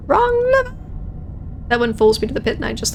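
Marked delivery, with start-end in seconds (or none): 0.1-0.8 very high put-on cry; mock high wail. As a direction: squeal it out, pitched way up. Mock cry after pulling lever.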